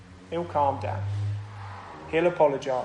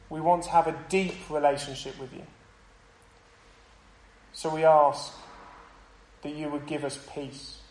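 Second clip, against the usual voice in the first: closing prayer. Acted. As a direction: pray gently for the congregation.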